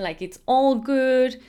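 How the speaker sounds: slow, easygoing and loud; relaxed Aussie shrug; laid-back drawl. Shown as a demonstration. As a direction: say it like nothing bothers you. Explaining Australians laid back.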